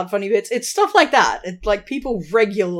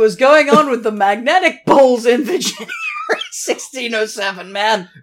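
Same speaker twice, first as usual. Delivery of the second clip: louder, higher, half-shouting disbelief; laughing outburst; 5 semitones higher, 8 dB louder than usual. Real, not acted. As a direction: shout out the absurdity. Laughing outburst over the compass.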